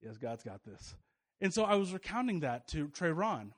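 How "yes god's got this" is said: much softer and lower, calm and certain; quietly sure that all will be well; imitating the woman who trusted God in the flood, calm; calm assurance. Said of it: "she's like".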